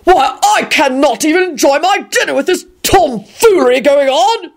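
high, pompous blustering; booming. A narrator's reading. Stuffy diner protesting the racket, booming out. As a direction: boom it out with pompous indignation. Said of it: "Richard boomed out".

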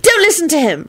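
high, cross command; high-pitched indignant order; furious. A narrator's reading. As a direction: cut off the troublemaker. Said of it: "told Elizabeth furiously".

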